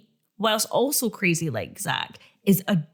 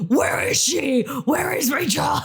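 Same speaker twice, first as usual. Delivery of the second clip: mock drunken shout, pitched up; drunk caller; 5 semitones higher than usual. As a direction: holler down the phone like a drunk. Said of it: "people used to phone her drunk".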